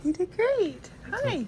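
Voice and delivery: bright greeting. Real, not acted. Greeting groggy husband.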